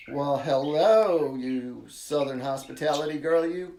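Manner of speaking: drawn-out mock Southern greeting; playful Southern drawl. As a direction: greet her in a slow, hammed-up Southern accent. Playfully greeting a North Carolina caller.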